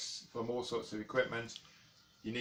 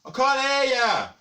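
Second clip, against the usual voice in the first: much higher and much louder; shouting; 14 dB louder than usual. Acted. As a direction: bellow like you're half deaf. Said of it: "Don't need to shout".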